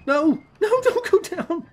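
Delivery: high, loud plea; panicked game cry. Real, not acted. Pleading as player falls.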